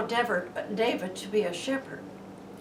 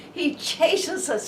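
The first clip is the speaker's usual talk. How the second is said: slow, higher and louder, each word stretched; emphatic preaching; 6 semitones higher, 5 dB louder, slower than usual. Acted. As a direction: press the words out slowly like a proclamation.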